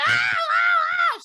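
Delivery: high, loud imitation screams; mock screams. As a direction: act out a horror-movie victim screaming. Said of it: "She's like".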